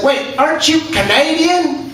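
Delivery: high, slow, incredulous question; mock disbelief, drawn out and high. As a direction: question it slowly, pitched well up. Quoting the redneck, puzzled.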